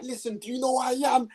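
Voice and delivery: retold shout. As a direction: confront the girls at full blast. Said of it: "I was like".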